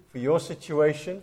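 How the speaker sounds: ordinary talk, nothing marked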